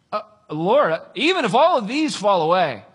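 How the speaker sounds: mock-heroic declaration of loyalty; bold vow. Peter protests to Jesus, bold and loud.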